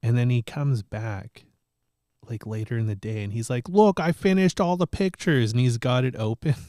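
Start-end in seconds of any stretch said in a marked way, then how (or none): 3.7-5.5 put-on higher, louder kid, showing off; a boy proudly showing his uncle; imitating proud kid. Nephew voiced in a story.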